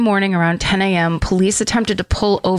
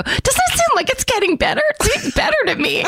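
high quoting voice; faster than usual. Retold or imitated. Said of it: "I go".

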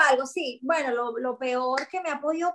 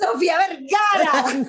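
louder burst through laughter; laughing exclamation; 3 semitones higher, 6 dB louder, slower than usual. Real, not acted. Friend guessing her celebrity double, laughing loudly. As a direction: blurt the name out laughing.

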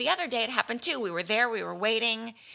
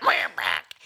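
deep frog croak; 4 dB louder than usual. Pretend. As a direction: drop way down into a low croaking voice. Mock frog sign-on, very low.

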